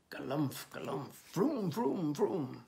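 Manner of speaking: stomping galumphs into revving vrooms; playful vocal sound effects, building; chase noises. Acted. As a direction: imitate heavy feet and engines. Imitates stomping chase and engines.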